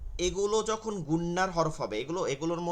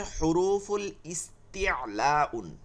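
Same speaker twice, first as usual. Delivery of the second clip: slow, drawn-out Arabic term; slow term introduction; much slower than usual. Shown as a demonstration. Teacher naming the Arabic term for students, slow and drawn out. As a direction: sound the term out syllable by syllable.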